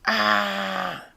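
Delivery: high, loud drawn-out "Ah!"; scream. A narrator's reading. Flattened professor, loud high scream. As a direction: scream out as if being squashed. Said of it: "he screamed".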